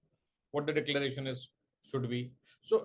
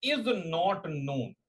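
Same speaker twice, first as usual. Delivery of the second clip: word-by-word dictation with long pauses; slow dictation; slower than usual. Real, not acted. Teacher dictating a definition to exam students, slow dictation. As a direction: dictate slowly, leaving time to write.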